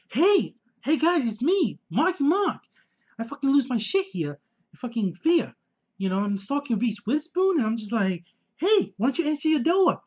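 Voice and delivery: high, hammed-up psycho [name] voice; [name] impression. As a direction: play the stalker with a manic grin. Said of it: "That's my [name] impression".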